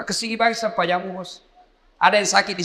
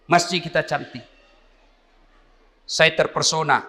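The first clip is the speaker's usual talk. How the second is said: ordinary delivery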